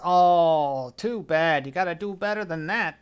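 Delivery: loud drawn-out "oh", then easing off; lightly voiced fairground showman's mock sympathy; taunting carnival barker. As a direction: tease the player for a weak try. Carnival man taunts after weak swing.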